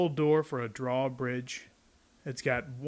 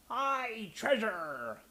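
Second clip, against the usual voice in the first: much higher chorus cheering; pirate crew cheering; 8 semitones higher, 4 dB quieter, much slower than usual. Acted. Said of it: "the others shouted".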